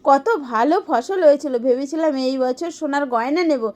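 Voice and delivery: ordinary delivery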